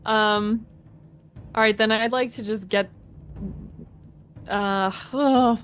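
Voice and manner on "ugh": ordinary talk, nothing marked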